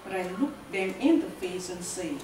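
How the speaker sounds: deliberate verse reading with long pauses; measured, declarative poetry delivery; poem recital. Acted. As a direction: punch each short phrase, then let it fall. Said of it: "it's titled My African Heritage".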